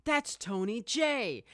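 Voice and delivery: high, drawn-out retold exclamation; excited recognition. Viewer spotting a favorite voice actor on screen, excited recognition. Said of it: "I was like".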